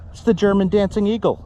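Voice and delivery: put-on high-pitched comic German accent. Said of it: "I did wrong accent".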